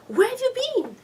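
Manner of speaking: a hurt, angry husband, pitched high; upset husband. Retold or imitated. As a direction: snap at her as she walks in. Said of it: "he was so upset at her".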